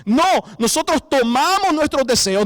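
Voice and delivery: ordinary talk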